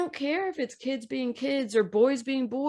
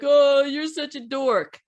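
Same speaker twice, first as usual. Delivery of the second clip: louder mocking jeer; mock schoolkid teasing; 3 semitones higher, 6 dB louder than usual. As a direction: imitate a kid's taunt, a touch higher. Mimics a kid teasing at school.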